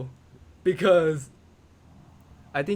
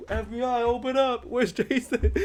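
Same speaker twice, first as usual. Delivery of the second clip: high-pitched mock police command; mock FBI raid; 9 semitones higher than usual. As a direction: play mom as a raiding agent, laughing by the end. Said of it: "like, an F B I, uh, raid on me".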